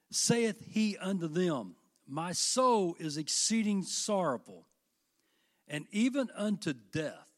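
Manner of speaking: slow, weighty reading of the verse; solemn scripture reading. Acted. Preacher reading Jesus' sorrowful words to the congregation, slow and solemn. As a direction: let each word of sorrow land slowly.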